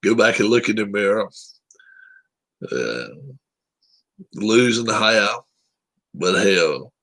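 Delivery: normal talking voice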